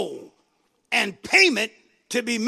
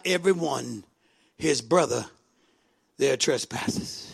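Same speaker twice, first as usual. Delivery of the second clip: low, grave reading, sinking softer at the end; solemn scripture reading; 6 semitones lower, 3 dB quieter than usual. Acted. Preacher reads the warning to the congregation, solemn.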